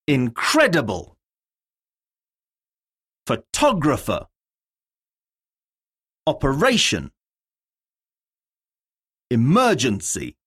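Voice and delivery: each word said alone, stressed syllable clearly hit. Shown as a demonstration. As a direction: model the stress for the class to repeat. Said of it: "Words have stress on different syllables".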